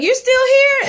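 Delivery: surprised father. Retold or imitated. Father character's surprised question.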